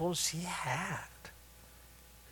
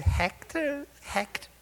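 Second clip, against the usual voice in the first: a touch higher, pointed questioning; lightly voiced old-timer; 4 semitones higher, 4 dB louder than usual. Old-timer challenging a newcomer who asked what to do, lightly voiced. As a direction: gently needle him with the question.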